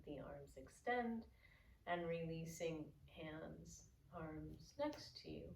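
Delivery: soft, calm yoga teacher voice; guided breathing cues. Real, not acted. Yoga teacher guiding inhale and exhale, soft guided breathing cues. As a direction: ease them through the breath, leaving long pauses.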